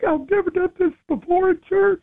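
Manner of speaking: host voicing a nervous old church lady; high little lady voice. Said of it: "she's like".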